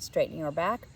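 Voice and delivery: plain reading voice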